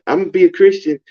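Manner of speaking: lightly voiced pious girlfriend; a hint of mock innocence; mimicking the chick. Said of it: "this is the chick telling me".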